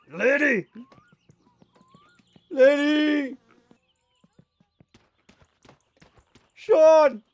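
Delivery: loud high shouts across the distance; calling out. Acted. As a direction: yell the names as if across a field. Calling out to game characters.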